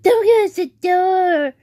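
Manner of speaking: high, loud, slow mock whine; imitating brother's tantrum. Recreating brother's late-night protest, high drawn-out mock tantrum. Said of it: "recreate last night".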